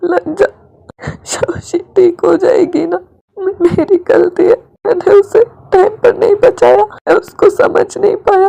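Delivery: high, tearful sobbing plea; crying. A narrator's reading. Wing sobbing into Wangji's arms, high and tearful. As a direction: sob it out, voice high and breaking. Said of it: "रोने लगता है".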